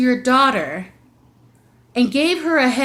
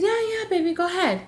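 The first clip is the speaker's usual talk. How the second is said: sweet coaxing; 9 semitones higher, faster than usual. Retold or imitated. Coaxing daughter toward her brother.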